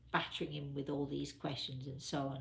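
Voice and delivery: ordinary talk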